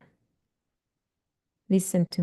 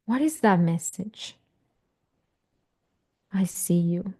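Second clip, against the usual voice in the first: guided meditation. Acted. Coach guiding parents through an inner child meditation, soothing. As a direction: talk them gently into the memory.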